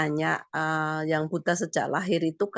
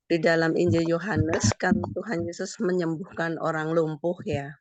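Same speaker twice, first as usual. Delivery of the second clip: normal talking voice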